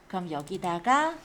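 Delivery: ordinary delivery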